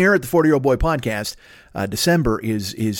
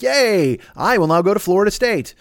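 higher-pitched mock-excited announcement; mock recruit; 6 semitones higher than usual. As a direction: put on a college kid's cheer, lightly. Mimics a college recruit announcing.

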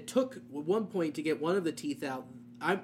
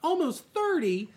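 incredulous; 11 semitones higher, 3 dB louder than usual. Retold or imitated. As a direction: stretch it out way up, outraged. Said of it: "I'm like".

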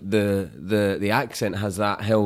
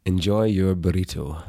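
Scottish accent. Shown as a demonstration. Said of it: "tell people Scottishly".

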